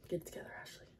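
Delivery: a private whispered nudge to regain composure; whispered self pep talk. Real, not acted. Vlogger steadying herself on camera, whispered self pep talk. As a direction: whisper to herself to pull it together.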